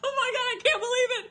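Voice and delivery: tiny high overwhelmed shriek; excited screaming. Real, not acted. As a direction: squeal breathlessly in disbelief. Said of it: "sorry for the screaming".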